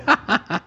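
short mocking chuckle; taunting laugh. Real, not acted.

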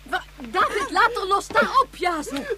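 high, loud breathless orders; high, loud frantic commands; frantic shouting. Acted. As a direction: haul them apart with your voice. Mother pulls kids apart.

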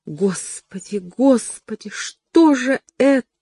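rising panicked despair; desperate dismay. A narrator's reading. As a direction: clutch your head in dread. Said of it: "повторяла она, хватаясь за голову".

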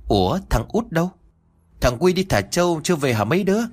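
lightly voiced anxious grandma calling the kids; grandma frowning. A narrator's reading. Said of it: "bỗng bà cau mày". (bà lo lắng hỏi, giọng hơi cao)

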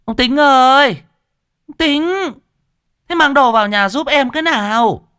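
high, loud call from the gate; wife calling from the gate, high and loud; calling loudly. Acted. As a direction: shout for the husband from outside, voice pitched high. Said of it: "đã kêu to".

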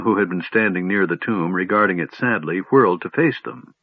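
usual speaking voice

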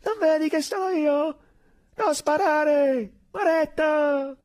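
very high, loud, slow begging; desperate shout. Acted. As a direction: scream for him not to shoot.

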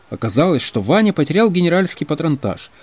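ordinary delivery, nothing marked

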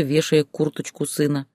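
ordinary delivery

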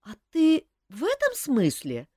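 puzzled, slowed question; ask, caught off guard; confused. A narrator's reading. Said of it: "растерянно переспросила она".

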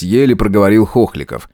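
plain reading voice

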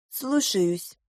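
soft, meek acknowledgement; subdued yes-sir; obedient reply. A narrator's reading. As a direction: answer the order quietly. Judge obeys the shouting general.

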